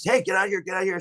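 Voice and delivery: high-pitched mock shout at the trees; retold yell. Scaring off the creature in the woods, high mock yell. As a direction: yell it while banging a stick. Retold yelling, smacking stick.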